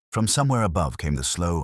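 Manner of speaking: plain reading voice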